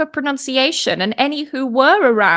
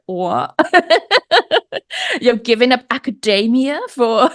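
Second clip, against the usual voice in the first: incredulous retelling broken by laughter; imitated disbelief. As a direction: mimic a taken-aback reaction, laughing through it. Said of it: "her face was just like".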